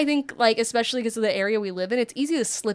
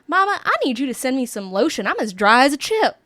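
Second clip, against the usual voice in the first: put-on Southern accent; 2 semitones higher, 4 dB louder than usual. Roommate on the phone to her mom, Southern accent. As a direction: put on a lively Southern twang. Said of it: "that's a different kind of Southern".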